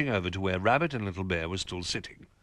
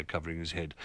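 usual speaking voice

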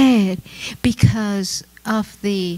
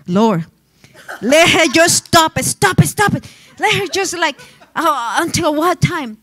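mock weary prayer; 7 semitones higher than usual. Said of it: "my mamacita is going like".

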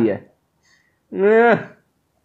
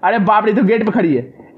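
ordinary talk

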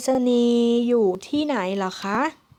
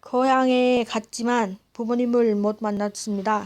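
native speed Korean; much faster than usual. Shown as a demonstration. Reading Korean example sentence.

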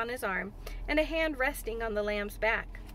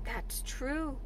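softer, slowly considered agreement; slow, thoughtful; 5 dB quieter than usual. A narrator's reading. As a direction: let the words out slowly and softly. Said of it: "he said slowly".

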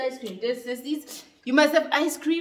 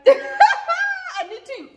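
laughing; 5 dB louder, much slower than usual. Real, not acted. Laughing through spicy pain.

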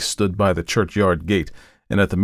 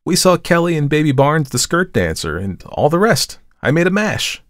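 put-on high, girlish gush of gossip; chattering; 9 semitones higher, 4 dB louder than usual. A narrator's reading. Model tells the artist about last night, chattering. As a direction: chatter away in a raised, lilting voice. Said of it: "she began to chatter".

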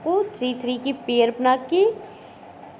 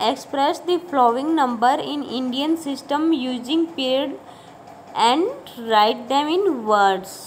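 reading the question out very slowly; slow, deliberate classroom dictation; much slower than usual. Shown as a demonstration. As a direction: spell it out word by word for students to copy. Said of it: "second वाले में क्या करना है".

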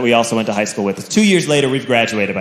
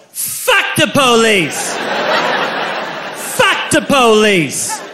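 put-on slow drunken chant, pitched up; drunk yell; 5 semitones higher, much slower than usual. A drunk teen yelling at the police chant. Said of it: "I yelled".

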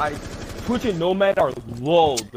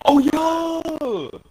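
high voice cracking on oh; 14 semitones higher than usual. Real, not acted. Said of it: "Oh, voice crack".

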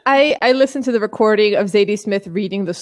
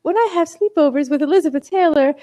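[name]; 6 semitones higher than usual. Retold or imitated. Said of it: "so [name]'s like".

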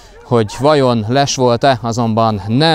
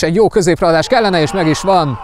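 excited hungarian sports commentary; 7 semitones higher than usual. Real, not acted. Cross and goal called live.

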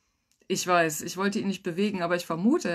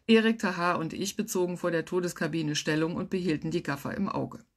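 ordinary delivery

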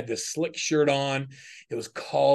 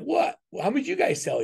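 shocked disbelief; 13 semitones higher than usual. Retold or imitated. Retelling his stunned reply to the rival salesman, high and incredulous. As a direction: jump way up in pitch, thrown by the news. Hearing rival sold far more.

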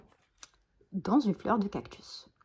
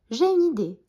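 louder, higher eager announcement; bright-idea exclamation; 6 semitones higher, 6 dB louder than usual. A narrator's reading. Billy tells his friend of an idea, eager exclamation. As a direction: pipe up with a sudden idea.

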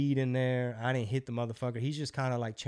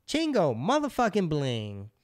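slow, high, drawn-out proclamation; grand greeting; 4 semitones higher, 3 dB louder, slower than usual. Retold or imitated. Comedian hailing his guest from upstairs, grandly proclaimed. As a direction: announce the guest with showman's relish, stretching each word.

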